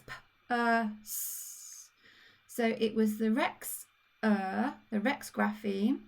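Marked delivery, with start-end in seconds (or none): 0.1-1.9 p, uh, s, each phoneme apart; sounding out. Shown as a demonstration. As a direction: sound out purse one phoneme at a time. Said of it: "the next one was purse".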